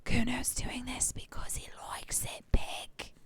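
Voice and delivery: hushed unvoiced kid whisper; sudden whisper. Kuno whispering to the detective, sly hushed whisper. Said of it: "he whispers suddenly".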